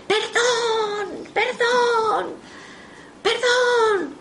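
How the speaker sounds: very high, shrieked cries of pardon with a sob; a tormented priest's spirit begging forgiveness; anguished shriek. Retold or imitated. Spirit of a priest through the medium, shrieking in terror. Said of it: "es chillar".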